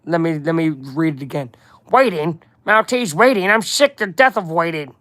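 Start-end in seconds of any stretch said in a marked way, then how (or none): 1.9-4.9 impatient mob boss, swooping pitch on the questions; put-on boss voice, sick of it all; Kingpin. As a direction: play an exasperated crime boss with slushy S sounds. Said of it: "You guys like my Kingpin voice?".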